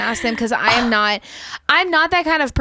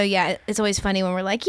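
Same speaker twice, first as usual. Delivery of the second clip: normal talking voice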